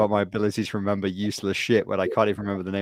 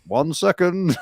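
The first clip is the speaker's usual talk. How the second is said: mock gruff English captain, raised and loud; 7 semitones higher, 5 dB louder, slower than usual. Captain answering through the door in the joke, gruff English voice. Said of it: "this gruff English voice go".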